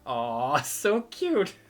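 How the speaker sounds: high, loud cooing through laughter; laughing at cuteness. Real, not acted. Streamer melting over the cute girl, cooing laugh. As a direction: coo and giggle at something adorable.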